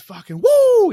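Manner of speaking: wild, high, loud woo of celebration; screamed whoop. Retold or imitated. Bloke celebrating his record to the boys, screaming woo. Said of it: "He's screaming, carrying on".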